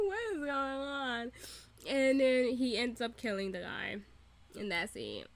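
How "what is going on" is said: laughing disbelief. Real, not acted. Laughing disbelief after scene.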